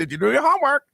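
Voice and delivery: high parrot squawk; parrot mimicking a parent. Host's parrot nags about homework, squawky. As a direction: pitch it way up, like a bird repeating you. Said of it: "the parent go".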